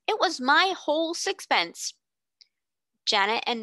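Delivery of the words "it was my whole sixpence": put-on much higher, insistent little boy; he stakes his whole fortune on it; proud boy.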